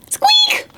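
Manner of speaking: very high little mouse squeak; tiny squeak. A narrator's reading. Storyteller makes the tiny noise Owl hears, high squeak. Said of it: "Then he heard the noise again".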